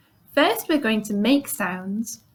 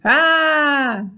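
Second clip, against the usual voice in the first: loud higher shouted ah; 6 semitones higher, 6 dB louder than usual. Shown as a demonstration. Teacher shouts ah for the pupils. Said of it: "and shout".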